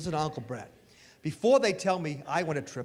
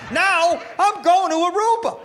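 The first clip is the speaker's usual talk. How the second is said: higher, louder and slow, laughing; gloating triumph; 12 semitones higher, 8 dB louder than usual. Real, not acted. Punchline to the audience, gloating triumph. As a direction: crow the win.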